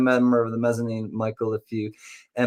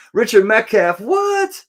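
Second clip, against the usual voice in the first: high, loud name then squealed question; hyped surprise; 10 semitones higher, 4 dB louder than usual. Pretend. Host reacting to Richard's membership, hyped surprise. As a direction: announce the name, then shoot way up on WHAT.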